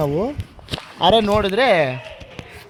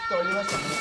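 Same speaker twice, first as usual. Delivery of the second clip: high sing-song announcement; high playful announcer call; 13 semitones higher, 7 dB quieter than usual. Acted. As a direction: announce it like a show host. Announcing car wash time.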